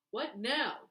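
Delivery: loud, sharp demand in the dark. A narrator's reading. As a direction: bark the question at them. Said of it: "demands a loud voice".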